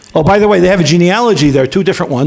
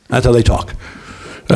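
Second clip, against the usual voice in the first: ordinary talk, nothing marked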